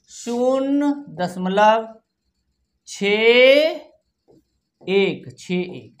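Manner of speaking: slow, drawn-out words; dictation. Shown as a demonstration. Teacher dictating a decimal to children, slow dictation. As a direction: stretch each word with a gap after it.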